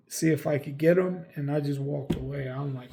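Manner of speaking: ordinary talk, nothing marked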